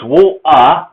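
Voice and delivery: Cockney "wo-uh", broken where the T was; wo, then uh, in split Cockney syllables; Cockney dropped T. Shown as a demonstration. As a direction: sound out "wo-, uh" Cockney-style, no T or R. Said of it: "we're not gonna pronounce T".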